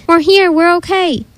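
high, drawn-out call. A narrator's reading. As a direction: call slowly to someone far away. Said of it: "if she didn't call out where they were".

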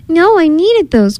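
high, slow storybook wail; crying out. A narrator's reading. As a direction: wail it lightly like an upset cub. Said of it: "cried Bee Bear".

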